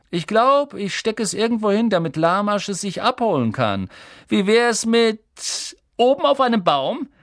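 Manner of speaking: put-on high, loud sneering boy; taunting Malfoy. Malfoy taunting Harry, high and sneering. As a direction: tease him, dangling it.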